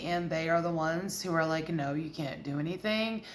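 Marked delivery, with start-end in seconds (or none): 1.7-3.2 low, quiet controlling partner; controlling Leo. Acted. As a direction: lay down the law softly and low. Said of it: "like, very controlling".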